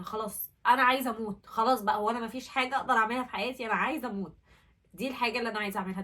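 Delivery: loud, insistent retold outburst; acting out a loud plea to be left alone; desperate protest. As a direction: push it out forcefully, fed up and desperate.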